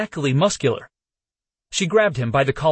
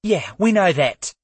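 spat; 6 semitones higher than usual. Acted. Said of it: "Layla spat".

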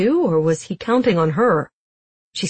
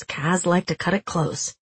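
plain reading voice

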